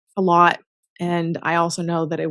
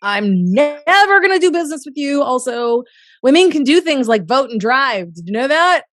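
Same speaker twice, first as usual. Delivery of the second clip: louder, higher mock-scolding retort; sassy comeback; 5 semitones higher, 5 dB louder than usual. Scolding a sexist dirtbag, louder sassy put-down. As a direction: tell him off with mock sass.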